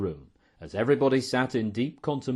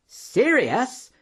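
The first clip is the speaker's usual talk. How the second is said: high, loud incredulous question; indignant cry; 13 semitones higher, 6 dB louder than usual. A narrator's reading. Professor Jaggles answering a mild remark, crying out. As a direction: cry it out, voice leaping up. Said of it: "cried Professor Jaggles".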